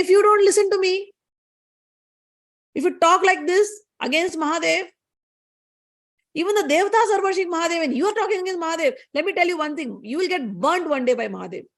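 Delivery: high-pitched scolding goddess; angry warning. Acted. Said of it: "Gauri got angry in the end".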